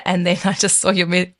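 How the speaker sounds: usual speaking voice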